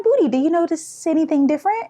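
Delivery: fishing for a reaction; teasing little sister. Retold or imitated. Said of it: "So she was like".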